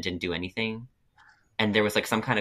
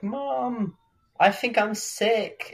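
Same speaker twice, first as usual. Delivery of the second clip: much higher, slow, small pitiful voice; pitiful sick kid; 12 semitones higher, much slower than usual. Retold or imitated. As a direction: whimper it to mom like a sick child. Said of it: "and I was like".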